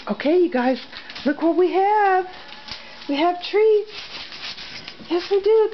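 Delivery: dog-talk voice. Real, not acted. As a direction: gush at the dogs like they're babies. Excited treat time with dogs.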